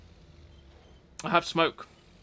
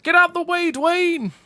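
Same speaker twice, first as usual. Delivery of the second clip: high, very loud panicked-style yell; mock screaming tank; 12 dB louder, much slower than usual. Charging Leclerc prototype yelling at Rock in its path, mock scream. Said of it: "screaming towards it, going".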